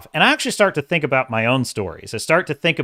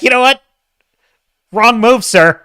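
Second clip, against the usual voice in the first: higher and louder, indignant scolding; mock SJW voice; 8 semitones higher, 6 dB louder than usual. Vlogger mocks the article writer in a mock SJW voice. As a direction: scold him like a prissy offended activist. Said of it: "You're getting the S J W voice for that".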